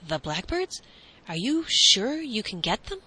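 eager questions, rising in pitch. A narrator's reading. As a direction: ask with growing eagerness. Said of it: "came towards them and asked eagerly".

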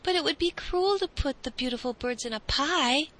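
mild, high-pitched objection; remonstrating. A narrator's reading. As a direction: protest as a lightly voiced boy. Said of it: "remonstrated Gilligren".

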